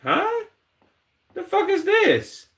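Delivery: very high, stretched baffled huh; stunned disbelief. Real, not acted. Streamer reacting to a gooner interview, in high stunned disbelief.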